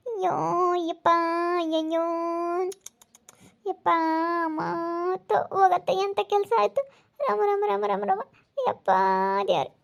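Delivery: high groans broken by pauses; pained groaning. Acted. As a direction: call on God through the pain. Acted patient groaning, calling on God. (ನೋವಿನಲ್ಲಿ ದೇವರನ್ನು ಕರೆಯುತ್ತಾ)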